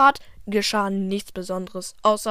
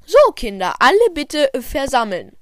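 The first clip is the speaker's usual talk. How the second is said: loud, higher teacher voice; teacher calling out; 6 semitones higher, 9 dB louder than usual. Acted. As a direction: call the kids together like a teacher.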